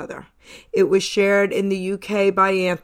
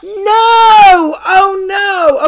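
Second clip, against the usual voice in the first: loud dismayed shout; 10 semitones higher, 11 dB louder, much slower than usual. Real, not acted. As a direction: cry out in mock dismay. Host mock dismay at answer.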